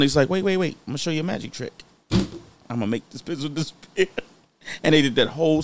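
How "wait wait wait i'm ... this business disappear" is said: lightly voiced Joker, hushed; a hint of the Joker, trailing softer; Heath Ledger. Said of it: "it says Heath Ledger".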